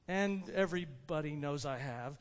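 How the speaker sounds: quiet parenthetical confession; confiding aside. Acted. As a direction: drop low and soft for an admission. Aside inside Zacchaeus's vow.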